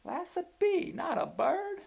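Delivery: Mama. A narrator's reading. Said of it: "Mama said".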